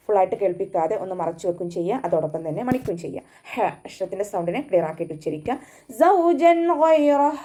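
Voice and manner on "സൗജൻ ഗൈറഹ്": high, slow chanted verse; recitation demo. Shown as a demonstration. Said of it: "തേനീച്ചയുടെ മൂളൽ പോലെ".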